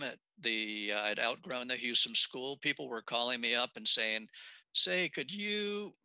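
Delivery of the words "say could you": high, slow imitated caller; tentative polite request. People calling to complain about hogged water, high tentative politeness. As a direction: draw out a hesitant favour, pitched high.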